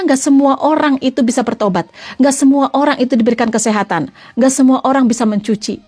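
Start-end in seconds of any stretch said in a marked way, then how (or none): none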